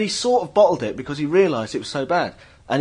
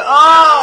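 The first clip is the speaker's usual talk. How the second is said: loud, high cringing "oh"; agonised groan; 12 semitones higher, 10 dB louder than usual. Acted. Listener cringing at a rocker's boast, pained loud groan. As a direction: wail as if the boast physically hurts.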